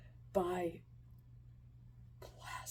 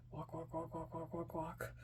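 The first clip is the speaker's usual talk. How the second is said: very soft, rhythmic repeated word; footstep imitation; 3 semitones lower, 10 dB quieter than usual. Kid trudging back into hell, muttered footsteps. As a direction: murmur it like little trudging steps.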